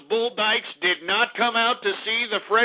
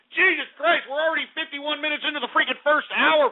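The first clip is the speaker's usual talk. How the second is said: exasperated; 9 semitones higher than usual. Real, not acted. Exasperated about time.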